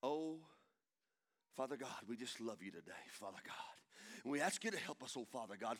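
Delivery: low, soft put-on praying; hammed-up pious prayer, sunk down low; mock prayer voice. Preacher imitating habitual church pray-ers, low mock prayer voice. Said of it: "then we have a prayer voice".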